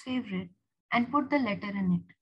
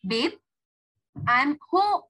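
raised, lilting homecoming call; 5 semitones higher, 5 dB louder, slower than usual. Acted. Julia to April as she opens the door, calling out. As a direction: call to a partner in another room.

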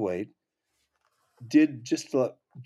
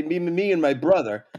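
stammered, raised and louder; stuck repeating the opening word; Bob Goldthwait; 5 semitones higher, 6 dB louder than usual. Retold or imitated. Comic showing how long friend took, stammering character voice. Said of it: "would take him forever to say".

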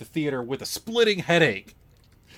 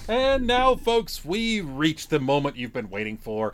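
higher, slow host intro with big build; announcer; 5 semitones higher, slower than usual. Acted. Podcast host to listeners, a mock announcer build-up. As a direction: announce the segment like a showman.

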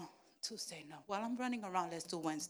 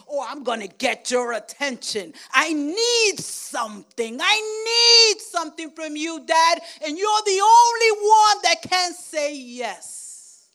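high, loud, crying plea; child pleading with dad for candy; whining child; 10 semitones higher, 17 dB louder than usual. Retold or imitated. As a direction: wail for dad's attention. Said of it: "he pulled, he screamed, he kicked".

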